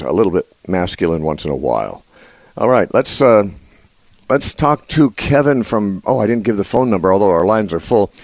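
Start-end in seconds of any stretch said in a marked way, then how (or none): none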